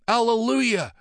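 high, loud ecstatic shout; joyful cry. Acted. Monk filled with the Spirit, crying out in joy. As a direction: cry out in rapture. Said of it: "cried Brother Ian".

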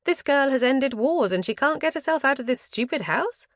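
high, incredulous character voice; companion's incredulous thought. Acted. Companion silently doubting the girl who ended wars, incredulous. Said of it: "I knew what she was thinking".

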